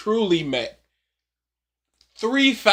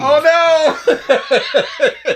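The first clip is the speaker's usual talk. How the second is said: loud, high "oh, no!"; loud exclaim; 10 semitones higher, 9 dB louder than usual. Real, not acted. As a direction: cry it out in mock dismay. Reacting to clip.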